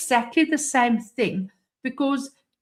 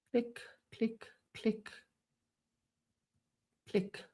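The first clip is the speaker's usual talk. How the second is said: muttered clicks; 15 dB quieter than usual. Real, not acted.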